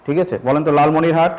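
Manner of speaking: ordinary talk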